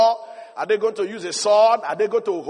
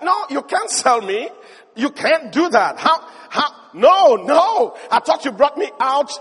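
rising, louder frantic pleading; panicked protest; 4 semitones higher, 5 dB louder than usual. Retold or imitated. Joseph pleading with his brothers not to sell him, rising panic.

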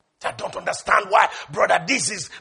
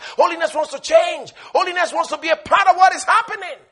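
building preaching; 6 semitones higher, 5 dB louder than usual. Acted.

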